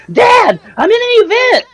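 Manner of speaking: put-on high, drawn-out excited kid shout; imitating excited son. Father imitating son running out, excited shout. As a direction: call out to dad, thrilled and high-pitched. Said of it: "he was so excited".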